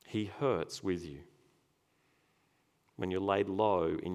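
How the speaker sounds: tender reassurance. Real, not acted. Consoling the grieving.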